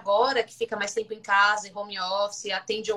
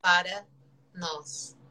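choppy broken-up call audio; words dropping out on a bad line; voice cutting out; 5 semitones lower, 3 dB louder, slower than usual. Real, not acted. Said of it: "A sua voz tá cortando".

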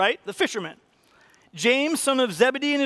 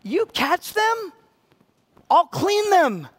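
God's promise; 7 semitones higher, 4 dB louder, much slower than usual. Retold or imitated. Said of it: "God's like".

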